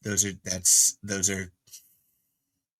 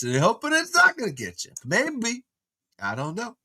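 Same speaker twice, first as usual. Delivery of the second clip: put-on drunk Christopher Walken; 7 semitones higher, 6 dB louder than usual. Streamer does a drunk celebrity impression for chat. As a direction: slur and lilt like a tipsy Walken. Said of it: "That was a drunk Christopher Walken".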